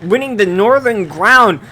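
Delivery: loud energetic esports caster; play-by-play casting. Acted. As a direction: call the action live and hyped.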